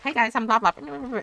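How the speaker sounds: high-pitched imitation of a streamer greeting; put-on high voice trailing into blahs; mock streamer intro. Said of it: "phone in his hand and is like".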